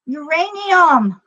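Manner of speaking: high, slow mock foreign accent; hammed-up accented sales pitch, drawn out; Hillary Clinton in mock foreign accent. Joking about a uranium sale, badly faked foreign accent. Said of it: "Hillary Clinton would probably say".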